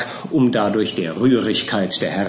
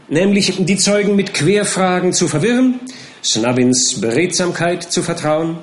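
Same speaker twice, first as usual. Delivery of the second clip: eager scheming; 7 semitones higher, 4 dB louder than usual. Acted. Perker lays out his scheme.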